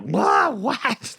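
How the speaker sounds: high shocked squeal. Retold or imitated. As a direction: squeal it in disbelief. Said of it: "she's like".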